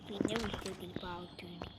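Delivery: soft unvoiced aside; whisper. Real, not acted. Gamer whispering an aside about the doll. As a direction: whisper it as a secret.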